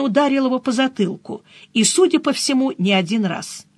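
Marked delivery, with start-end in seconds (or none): none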